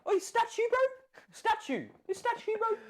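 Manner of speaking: high-pitched mock New Zealand calls; Kiwi accent. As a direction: jeer in a put-on Kiwi accent. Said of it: "I've been doing this accent".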